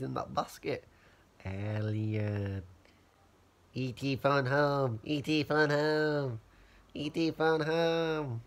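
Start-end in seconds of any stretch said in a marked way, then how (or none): 1.4-2.6 drawn-out alien call; a light alien voice; E.T. impression. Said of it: "impersonation of Elliot". 3.7-5.0 slow, drawn-out alien croak; lightly done slow alien voice; E.T. impression. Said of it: "my movie impersonation of Elliot". 5.0-6.4 slow alien voice with a changed timbre; slow hammed-up creature plea; E.T. impression. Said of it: "my movie impersonation of Elliot". 6.9-8.4 slow, drawn-out alien plea; lightly voiced slow alien; E.T. impression. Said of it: "my movie impersonation of Elliot".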